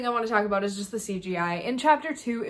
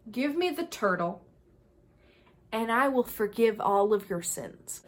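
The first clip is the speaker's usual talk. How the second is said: ordinary talk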